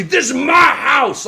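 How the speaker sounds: high, slow trash-talk yell; trash talk shout. Retold or imitated. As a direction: shout it like you own the arena. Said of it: "talking shit at Milwaukee".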